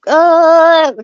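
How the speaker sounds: loud, high imitated cat noise; cat's grunt. Imitating the cat with glue trap, loud high noise. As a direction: blurt a loud drawn-out "uh" as the cat. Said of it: "she's like".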